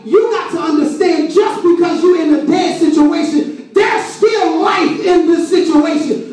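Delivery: high-pitched, impassioned sermon shout; full-force preaching. Real, not acted. Preacher promises life in a dead situation, full-force preaching. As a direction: drive it home like a preacher at full cry. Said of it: "I feel preaching now".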